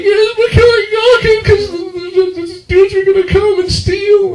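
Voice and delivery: very high, frantic squealed excuse; hammed-up terrified child explaining; panicked child. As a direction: plead in a falsetto kid's wail. Child pleading with furious mother.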